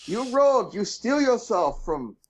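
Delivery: high, grand mock oracle; fortune cookie voice. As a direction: read it like a cheesy fortune. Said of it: "fortune cookie saying".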